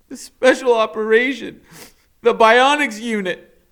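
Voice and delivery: high, loud, upset; shouting back. Acted. Max answering the doctor, loud and upset. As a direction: fling the answer at her.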